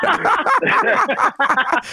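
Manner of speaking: high, very drawn-out laugh into words. Real, not acted. Laughing as he admits what he thought his friend would pick.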